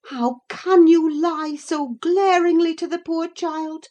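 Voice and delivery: high, loud reproach; indignant call. A narrator's reading. Narrator calling from inside to the lying rider, indignant. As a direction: call out in outrage, pitched well up. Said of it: "I called from the inside".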